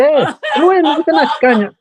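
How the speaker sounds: high, laughing exclamation. Real, not acted. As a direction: squeal it out while laughing. Laughing during banter.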